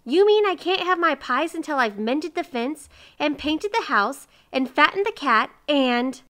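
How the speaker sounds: high, loud, outraged listing; indignant protest. A narrator's reading. Miser objects to working for pies, indignant. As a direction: pile up the chores in mounting protest.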